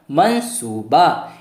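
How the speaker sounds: मनसूबा stretched out slowly syllable by syllable; slow careful pronunciation. Shown as a demonstration. Teacher modelling slowly for students: मनसूबा, मन-सू-बा. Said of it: "अक्षर को मिला मिला कर पढ़ेंगे".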